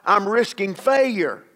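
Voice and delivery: ordinary talk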